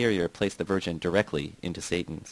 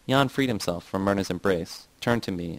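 usual speaking voice

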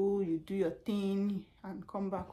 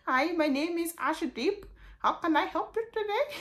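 put-on Ashdeep Indian accent; 8 semitones higher, 3 dB louder than usual. Tech support agent greets the caller in an Indian accent. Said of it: "I pray it's an Indian".

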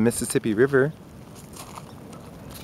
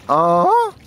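usual speaking voice

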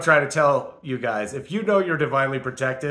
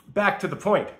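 usual speaking voice

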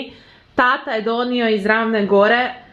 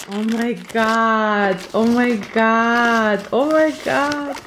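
slow drawn-out oh my god; shrieking jackpot winner; much slower than usual. Real, not acted. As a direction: gasp it out in disbelief. Excited unboxing of gift box.

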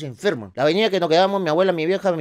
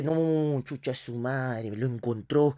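lamento de descubierto; 4 semitones lower, 8 dB quieter, much slower than usual. Acted.

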